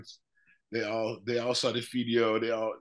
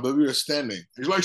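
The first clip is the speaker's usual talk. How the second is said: usual speaking voice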